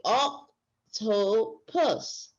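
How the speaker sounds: octopus said very slowly, syllable by syllable; segmented slowly. Shown as a demonstration. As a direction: stretch octopus into its parts. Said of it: "then you're gonna have them blended".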